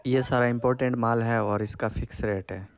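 ordinary talk

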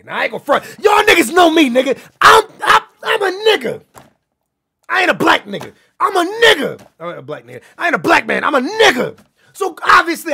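shouting at the chat to make the point; heated shout. Real, not acted. Streamer yelling at his chat, fired-up shout.

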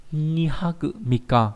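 slow, drawn-out dictation of a word; slow model reading. Shown as a demonstration. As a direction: read it out slowly for learners. Teacher models the reading slowly.

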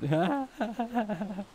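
high-pitched excited laughter; real high giggly laugh. Real, not acted. Laughing after an excited outburst.

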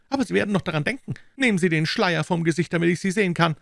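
high-pitched, fast, furious character voice; angry outburst. A narrator's reading. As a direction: rattle out angry threats and demands, pitched high. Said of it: "in einem plötzlichen Wutausbruch". (wütend, mit hoher Stimme)

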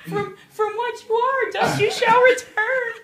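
high, slow, swelling mock lament; mock tearful. Aunts and uncles reciting over Nana's ashes, mock tearful. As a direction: recite it as a wailing mourner, hammed up.

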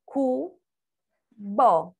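each syllable of cubo on its own; separated syllables. Shown as a demonstration. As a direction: say Cu and bo as two isolated syllables. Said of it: "A sílaba separada".

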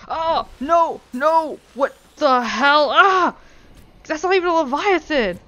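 high, loud panicked yelps; frantic shrieking; panicked screaming. Real, not acted. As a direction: scream in real alarm at the monster. Panic at a Leviathan appearing.

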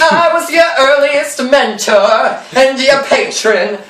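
slow, singsong self-important voice; mock pompous mentor. Pumblechook claiming to be Pip's patron, mock pompous. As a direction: intone it slowly like a puffed-up patron.

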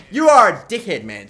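loud, high burst of frustration; angry insult. Real, not acted. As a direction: lash out at your coach. Insulting his coach over missed assist.

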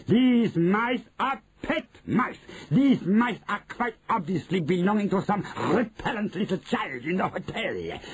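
high, emphatic witch declaration; shouting witch. A narrator's reading. Witch announcing pet mice to the audience, shouting high. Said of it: "she shouted".

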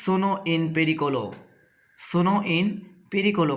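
slow, syllable by syllable; slow demo. Shown as a demonstration. Teacher to new arrivals in Italy, slow careful demo. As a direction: lay out each Italian word slowly for learners. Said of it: "এই কথা বলতে".

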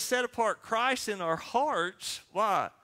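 usual speaking voice

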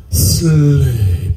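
low, drawn-out command to sleep; hypnotic sleep command. Acted. Hypnotist putting the subjects under, commanding and drawn out. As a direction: drop low and stretch the word.

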